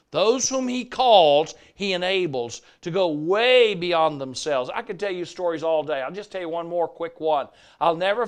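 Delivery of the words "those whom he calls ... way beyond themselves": preaching. Acted. Preaching emphasis.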